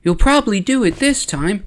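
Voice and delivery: put-on high-pitched little kid voice; Kixter. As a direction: pipe up high like a small friend. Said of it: "her little friend Kixter is like".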